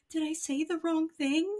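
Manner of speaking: high, anxious little voice; worried boyish whimper; timid high voice. Retold or imitated. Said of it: "Yugi will be like".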